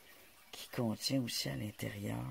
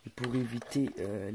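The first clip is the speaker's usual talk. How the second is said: normal talking voice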